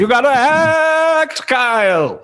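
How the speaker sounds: high, loud, slow over-the-top acting voice; mock theatrical bellow, drawn out; hammy actor. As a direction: ham it up like a stagey drama coach. Mocking his old idea of acting.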